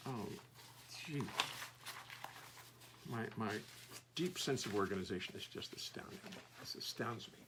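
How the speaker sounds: whispered dry self-mockery; deadpan whisper; sarcastic whisper. Real, not acted. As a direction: whisper it like a wry confession. Self-mocking his own messiness.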